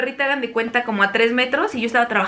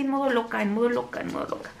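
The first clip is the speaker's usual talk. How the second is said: trailing off, softer each time; fading to a whisper; 7 dB quieter than usual. Real, not acted. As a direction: let it die into a whisper. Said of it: "fading repetition". (en modo loca, apagándose hasta un susurro)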